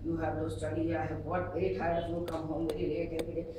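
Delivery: low, soft droning parental lecture; tired parent lecturing a teenager, sleep-inducing; monotone lecture. Acted. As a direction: drone on at one low pitch. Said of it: "when you lecture the teenage youngster".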